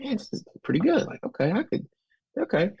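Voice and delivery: high, fast, thrilled reaction; excited realization. Retold or imitated. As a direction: gush like you just found something great. Reacting to podcasts, voiced.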